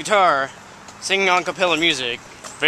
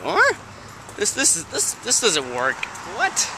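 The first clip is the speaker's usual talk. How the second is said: mock disbelief; 6 dB quieter than usual. Baffled friend hearing of magic wishes, mock disbelief. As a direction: stammer in exaggerated confusion.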